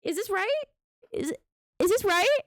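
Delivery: rising, increasingly high incredulous questions; mock polite question building to a high, loud "is this right?". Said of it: "when we question anybody about it and we say".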